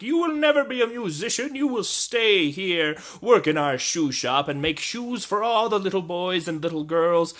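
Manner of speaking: loud, high, hammed-up scolding parent voice; mock family voice. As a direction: bark it like a stern cartoon parent. Family voiced mocking a stereotype.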